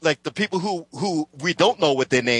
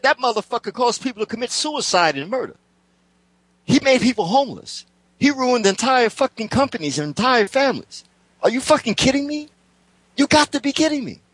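retold outrage; 8 semitones higher than usual. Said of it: "I said".

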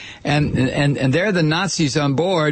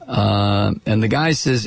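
ordinary talk, nothing marked

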